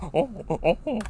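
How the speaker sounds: mock pained moans like a tortured man; imitated moaning. Mocking the caller's moans to a friend, short groaning oh sounds. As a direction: ham up a caller's creepy moaning. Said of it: "guy moaning sounds like he's a worm".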